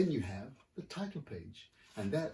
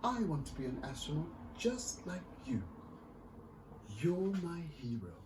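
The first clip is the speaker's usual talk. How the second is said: put-on excited little girl; 8 semitones higher than usual. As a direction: pour out joy to her returning mother. Astrid reunites with Mama.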